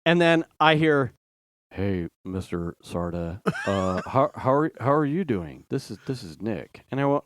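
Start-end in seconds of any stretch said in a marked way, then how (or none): 1.7-6.8 put-on low, hesitant drunk kid voice; drunk teen boy. Dad imitating his daughter's drunk friend Nick, low and hesitant. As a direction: mumble it like a tipsy teenager.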